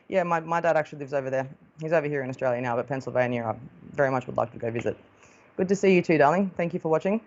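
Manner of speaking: ordinary talk, nothing marked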